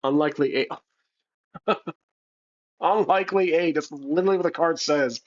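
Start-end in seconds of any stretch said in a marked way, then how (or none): 2.8-3.7 higher-pitched, amazed repeat; amused disbelief. Real, not acted. Player repeats the lucky card after laughing, amused disbelief. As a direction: read it out as an unbelievable coincidence.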